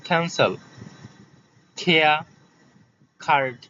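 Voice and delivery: "ক্যান্সেল", "খেয়া", "কার্ড" with puffed k; separated model words, airy k as in "খেয়া"; aspirated K. Shown as a demonstration. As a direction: say "কার্ড" with a kh onset. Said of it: "ক্যানকে খ্যান বলবা".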